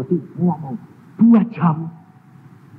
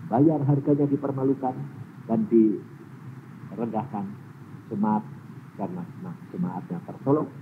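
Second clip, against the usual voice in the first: God's voice; 5 semitones lower, 6 dB quieter than usual. Retold or imitated. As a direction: speak as gentle God. Voice of God, low and soft. (suara Tuhan yang pelan dan rendah)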